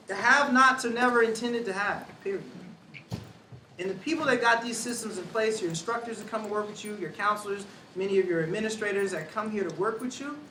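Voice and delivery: ordinary delivery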